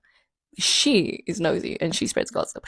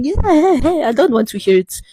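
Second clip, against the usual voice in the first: sharp retort; 8 semitones higher, 5 dB louder, slower than usual. Retold or imitated. Cutting off stepdad in argument.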